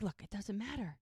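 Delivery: retold calming interjection; peacemaking interruption. Lily stepping in to settle the squabble, mildly firm.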